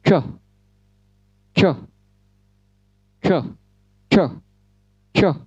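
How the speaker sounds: "coh" as a rounded English ch; isolated ch demo on "coh"; English ch sound. Shown as a demonstration. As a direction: burst "coh" through rounded lips. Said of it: "lalu ucapkan".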